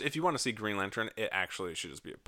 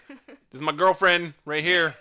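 mock proclaiming; 7 semitones higher, 10 dB louder than usual.

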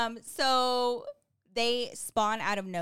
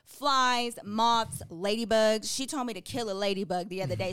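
ordinary talk, nothing marked

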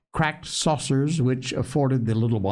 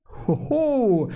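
high, drawn-out gleeful hoot; 10 semitones higher than usual. A narrator's reading. Little Phronsie seeing the gingerbread, gleeful. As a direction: hoot it high with childish delight.

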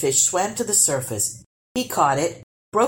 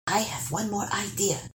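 quiet dwarf voice; 3 semitones higher, 7 dB quieter than usual. Acted. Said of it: "said the dwarf".

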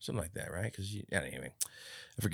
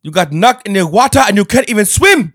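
loud, high, hammed-up mocking outburst; Rob; 12 semitones higher, 17 dB louder than usual. Teasing co-host with an impression of him, loud mock outrage. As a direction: shout it high like an outraged scolder. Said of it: "I was just doing an impression of you".

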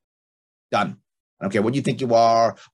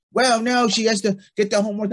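higher and louder, playing the insistent wife; wife defending the homework battle, raised and insistent; wife insisting; 9 semitones higher, 4 dB louder than usual. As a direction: push back as the wife would. Wife's side of the quarrel.

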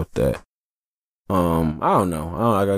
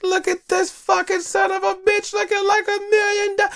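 teasing buddy. Retold or imitated. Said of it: "I'm like".

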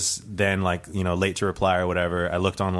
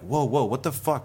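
startled protest; 6 semitones higher than usual. Retold or imitated. Ticket buyers protest to the club manager, mock startled. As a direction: act out being taken aback.

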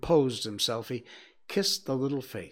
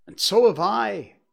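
higher and louder, eager; eager cry; 8 semitones higher, 9 dB louder than usual. Acted. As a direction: blurt it out eagerly. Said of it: "cried Peter".

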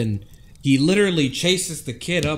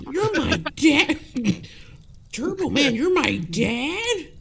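very high, slow disbelieving squeak; shocked kid; 14 semitones higher, slower than usual. Acted. Kid learning Turbo Man is his dad, high shocked squeak. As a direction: gasp it out like a stunned boy.

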